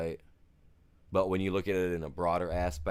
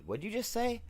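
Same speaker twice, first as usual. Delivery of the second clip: high, incredulous mock brother; brother's puzzled question. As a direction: imitate a baffled brother, pitched high. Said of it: "and he goes".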